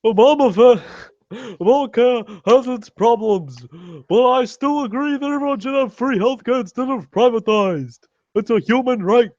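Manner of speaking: put-on high, strained old-man campaign voice; Bernie Sanders impression. Lampooning Bernie's campaign pitch in a high, strained voice. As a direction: pitch way up and rant like a stump speech. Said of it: "my Bernie Sanders impersonation".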